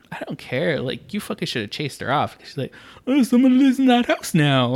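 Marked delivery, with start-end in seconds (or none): 3.1-4.8 high, put-on voice of a neighbor; mock neighbor. Retelling the neighbor's remark to friends, high mocking voice. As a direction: mimic her in a high pitch.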